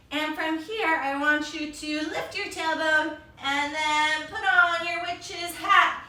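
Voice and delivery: normal talking voice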